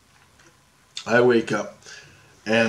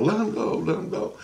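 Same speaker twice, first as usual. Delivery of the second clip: fading, half-hearted imitation of a scream; retold scream; 7 semitones higher, 4 dB quieter than usual. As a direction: replay the cry without real force. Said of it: "he's screaming as he's coming".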